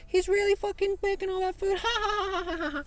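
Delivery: very high, squealing mock of laughing coworkers; mocking laughter. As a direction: imitate coworkers jeering, breaking into a high cackle. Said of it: "They're just laughing at me".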